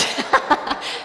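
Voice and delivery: real burst of laughter; laughing. Real, not acted. Preacher cracking up at own sheep joke, laughing. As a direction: crack up helplessly.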